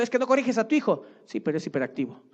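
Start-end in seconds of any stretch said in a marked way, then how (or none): 0.0-1.0 fast, high scolding rebuke; preaching rebuke. Retold or imitated. Preacher rebuking a lax parent, fast and high. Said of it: "estoy predicando y estoy diciendo".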